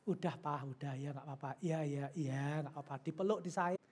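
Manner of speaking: calm him down gently and softly; coaxing wife. Acted. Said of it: "istri itu yang ngalem-ngalem aja".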